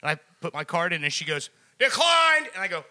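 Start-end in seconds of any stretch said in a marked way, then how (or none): 1.8-2.5 loud, high call of Declined!; yelled announcement. Retold or imitated. Cashier yelling at the customer, loud and high. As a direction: bark it out for the whole store. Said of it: "yelling the word decline out".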